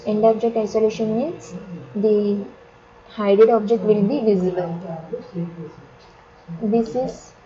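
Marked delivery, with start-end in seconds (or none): none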